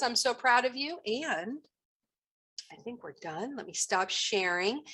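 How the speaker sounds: normal talking voice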